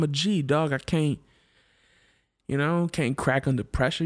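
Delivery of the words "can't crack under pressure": normal talking voice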